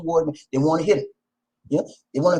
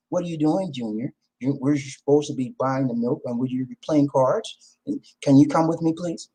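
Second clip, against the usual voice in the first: gentle, much softer request; soft polite wife; 5 semitones lower, 4 dB quieter than usual. Retold or imitated. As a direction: ask sweetly and softly, no edge. Voicing polite assertive wife.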